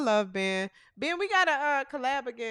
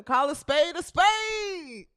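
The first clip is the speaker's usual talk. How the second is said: slow, drawn-out catchphrase call; imitating his catchphrase. Host imitating a fellow show's host, drawn-out catchphrase. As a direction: stretch the last word out as his signature call. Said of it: "That's how he said".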